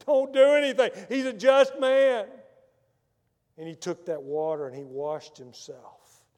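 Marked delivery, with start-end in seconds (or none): 0.1-2.3 Pilate's wife pleading, higher and louder; wife begging Pilate to let him go, loud and high; urgent warning. Acted. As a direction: cry out a warning to your husband.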